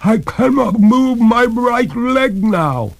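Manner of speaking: very high, slow, loud wail; desperate ghost lady crying out; despairing cry. A narrator's reading. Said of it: "cried the ghost in despair".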